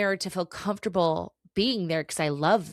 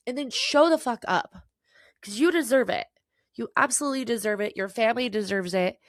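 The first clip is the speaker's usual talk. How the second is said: fired up; 5 semitones higher than usual. Real, not acted. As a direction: pump them up, push it hard. Fired-up pep talk.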